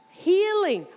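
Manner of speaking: normal talking voice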